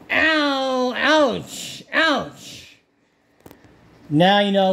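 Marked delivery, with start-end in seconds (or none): 0.1-2.8 high yelps of pain; cries of pain. Acted. Owl yelping after the lion bites. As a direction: yelp as the jaws bite down. Head bitten in lion's mouth.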